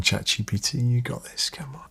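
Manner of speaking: whispered encouragement at the screen; hushed come-on; whispered urging. Real, not acted. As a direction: coax it under your breath. Urging ChatGPT on.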